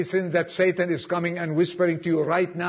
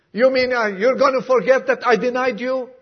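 amazed disbelief; 6 semitones higher, 5 dB louder than usual. Retold or imitated. Peter amazed at forgiveness.

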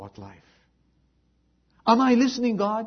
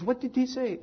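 concerned mother; 9 semitones higher, 4 dB quieter than usual. Retold or imitated. Mother questions her upset daughter.

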